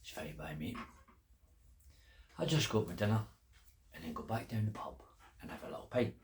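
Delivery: low, soft inner thought; unbothered musing. Acted. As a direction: mutter to yourself, calm. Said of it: "thought the farmer".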